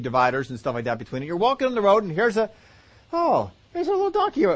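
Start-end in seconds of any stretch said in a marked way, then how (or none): 3.1-4.4 high, light sing-song remark; chirpy surprise. Acted. Traveller on the road noticing the donkey, high cheerful surprise. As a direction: sound pleasantly surprised, unaware of danger.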